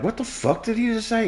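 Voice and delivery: high, hushed mock disbelief; judgmental crowd. Comedian voices the quieted crowd, mock judgmental. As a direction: imitate a shocked, judging audience under the breath. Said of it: "you can feel the judgment".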